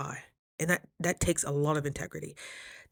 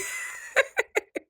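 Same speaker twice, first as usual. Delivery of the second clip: airy breathy giggle. Real, not acted. Laughs at own story.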